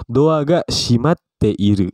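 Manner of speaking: slow, drawn-out example sentence with a held break in shimatte; slow model reading. Shown as a demonstration. Teacher models a Japanese example for students, slowly. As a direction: stretch out "shimatte iru" for learners.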